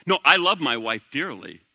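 ordinary talk

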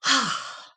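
a long tired sigh; audible sigh. Real, not acted. Sighing to the listeners after a weepy episode, audible sigh. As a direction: let out a sigh of relief. Sighs after the emotional talk.

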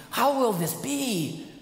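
high, slow doubting How will this be; doubting question. Acted. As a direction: voice the doubting flesh. Sinful flesh asking doubting.

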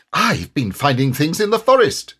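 proud, self-important Tigger; a boastful young Tigger reporting his finds. A narrator's reading. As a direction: puff himself up as he announces it. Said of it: "said Tigger importantly".

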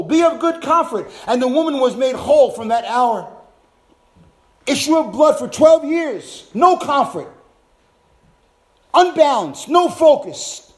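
loud, high fervent preaching; preaching at full force. Acted. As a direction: hammer each phrase home.

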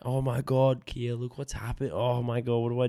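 mock hysterical. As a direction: replay the panicked giggly outburst, lightly. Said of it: "laughing hysterically, like, yelling out".

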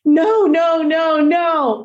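high, pleading mock scream; retold scream. Dog owner crying out at oncoming traffic, retold high-pitched scream. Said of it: "I just scream out".

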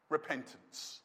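low, hushed "Repentance"; solemn key word. Real, not acted. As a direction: drop right down and let "Repentance" land softly. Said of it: "I'll say it again".

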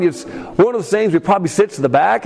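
ordinary delivery

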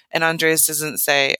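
ordinary talk

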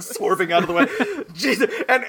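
usual speaking voice

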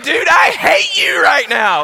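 high, loud, slow outburst at a friend; mock furious yell. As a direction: yell it in hammed-up betrayal. Learns pastor made the prank call.

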